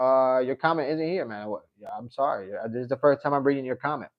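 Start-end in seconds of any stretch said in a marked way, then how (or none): none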